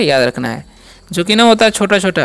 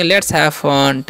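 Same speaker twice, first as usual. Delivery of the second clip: slow example sentence; 5 semitones lower, much slower than usual. Shown as a demonstration. Teacher modelling an English sentence, very slow. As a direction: say each word slowly for learners. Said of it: "तो बोलेंगे".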